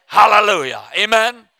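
ordinary talk

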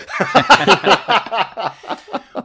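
big high burst of laughter; loud laugh. Real, not acted. Laughing at his own joke.

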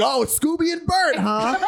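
very high-pitched sarcastic disbelief; squeaky-high mock outrage ending in a challenge; mock incredulous. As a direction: imitate an irate man not buying it. Imitating irate man disbelieving fake names.

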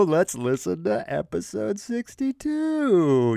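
slow, teasing "episode sixty-two" like a grand reveal; mock drawn-out announcement. Podcast host teasing listeners with the episode number, slow mock announcement. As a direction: drag it out as a running joke. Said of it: "I'm just gonna keep doing that".

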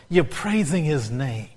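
usual speaking voice

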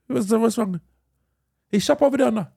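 put-on high, questioning Indian accent; hammed-up Indian English; mock Indian accent. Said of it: "an Indian twang".

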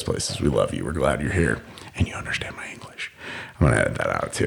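low, whispery joking aside; hushed aside. Pretend. Podcaster reassuring foreign listeners in jest, whispered. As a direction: drop to a confidential whisper.

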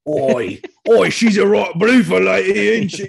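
loud, hammed-up cockney chimney sweep; British chimney-sweep accent. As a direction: holler it in mock cockney. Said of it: "British chimney sweeps".

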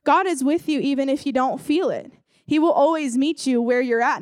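usual speaking voice

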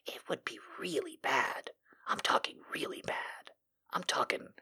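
hushed mock-sinister whisper; menacing whisper. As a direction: whisper the threat like a villain.

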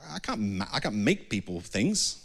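ordinary talk, nothing marked

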